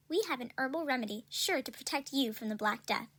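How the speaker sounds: usual speaking voice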